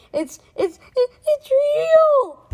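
stutter, then draw out a shriek; terrified stammer. A narrator's reading. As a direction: stammer building to a high, loud wail. Frightened girl stammers at creature.

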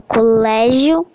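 "colégio" slowly, with LÉ stressed; "colégio" as a slow stress example on LÉ; stress on penultimate syllable. Shown as a demonstration. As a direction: draw out "colégio", leaning on LÉ. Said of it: "cuja sílaba tônica é a penúltima".

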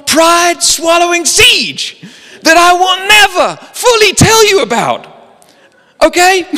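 high, loud hammed-up rant; mock desperate shouting, stretched out slow; over-the-top exasperated agent yelling; losing it. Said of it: "he starts losing it".

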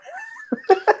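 high laugh. Real, not acted. Laughs at his own line.

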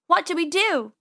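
panicked. Acted. Trapped, Penny panics.

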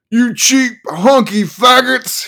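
high, loud, slow snarl; vicious loud snarl. Acted. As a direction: snarl it at the crowd. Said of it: "he snarled".

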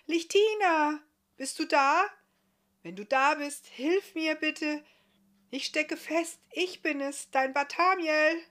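high, full-voiced shout for help; bellowing call. A narrator's reading. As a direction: bellow out into the distance. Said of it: "dann brüllte er".

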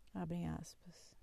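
whispered opening cue; a breathy aside; dictating. Acted. Dictating quote marks.

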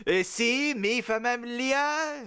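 high, slow mock Spanish; muddled Spanish-French accent. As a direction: stretch it out in a fake Spanish accent. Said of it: "That's definitely Spanish".

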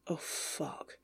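breathless whispered dread; whispered curse. Acted. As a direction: breathe it out in dismay. Whispered dread at incoming call.

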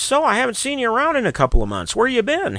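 chatty barber. Acted. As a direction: lift the voice into a curious, chatty barber. Voices the barber in a joke.

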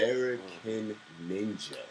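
slow, low, drawn-out announcement; slowed-down repeat. Retold or imitated. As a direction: break the name down slowly. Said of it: "I had to slow that and break it down".

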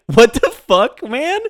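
a hint of an exasperated yell; mock outburst. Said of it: "it's like".

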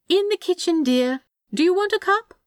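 high, lilting call; unbothered calling out. A narrator's reading. Angel calling from the kitchen, cheerfully unbothered. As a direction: sing it out casually to another room. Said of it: "Aziraphale called from the kitchen, completely unbothered".

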